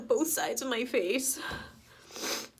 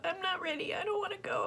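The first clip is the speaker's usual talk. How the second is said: breathy, higher broken plea; imitating a tearful plea; 7 semitones higher, 6 dB quieter, faster than usual. Quoting Peter Parker's dying plea to Tony with a soft tearful break. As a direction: softly echo his scared cry, almost whispered. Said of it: "he cried out".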